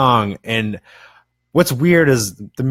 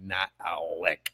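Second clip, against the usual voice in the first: sheepish admission; 4 semitones lower, 12 dB quieter than usual. Retold or imitated. Sheepishly admits he speaks no Spanish.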